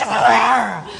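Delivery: loud imitated lion snarl; lion's roar. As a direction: roar like a charging lioness. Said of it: "she goes".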